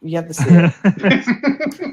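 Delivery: slow, drawn-out insistence; emphatic insistence. Real, not acted. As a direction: really lean on it so they believe you. Emphatic repeat, far, slow.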